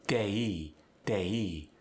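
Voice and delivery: "Day" gliding from eh into ee; exaggerated diphthong. Shown as a demonstration. Teacher proving a point, exaggerated glide in "Day". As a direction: stretch both vowel sounds in "Day". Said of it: "slightly exaggerating to prove a point".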